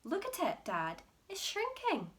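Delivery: alarmed. Acted. George telling Dad about the hen. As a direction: point it out in alarm.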